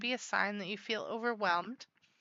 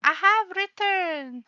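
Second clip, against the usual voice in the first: grand announcement; 9 semitones higher, 7 dB louder, slower than usual. Pretend. Grand return after bathroom break.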